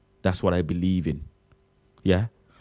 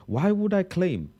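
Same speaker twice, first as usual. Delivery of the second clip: high, slow, outraged question; mock outrage; indignant disbelief; 9 semitones higher than usual. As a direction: act offended by the idea. Indignant denial of Bangladesh.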